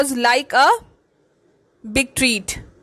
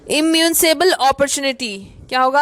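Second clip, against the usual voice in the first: normal talking voice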